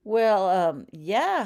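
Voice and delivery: startled sputter. A narrator's reading. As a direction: stumble over the words, caught out. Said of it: "Holly sputtered".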